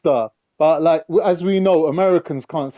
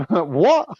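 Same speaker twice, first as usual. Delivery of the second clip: normal talking voice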